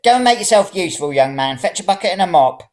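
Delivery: high, loud barked orders; bossy old woman. A narrator's reading. Old woman orders the young man to fetch a mop, high and bossy.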